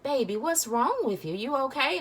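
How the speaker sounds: high, sweet little Southern-lady voice. Retold or imitated. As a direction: coo it in a sweet Southern accent. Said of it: "in her sweet little Southern voice".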